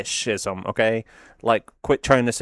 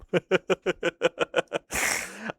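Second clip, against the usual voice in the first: high soft giggle; 7 semitones higher, 5 dB quieter than usual. Real, not acted.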